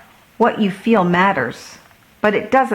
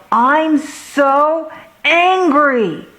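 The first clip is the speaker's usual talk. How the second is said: put-on slow, higher angry child yell; 8 semitones higher, 3 dB louder, much slower than usual. A narrator's reading. Alex yelling after his pirate ship is wrecked, slow angry yell. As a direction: stretch out a furious cry over the ruined ship. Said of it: "and yelled".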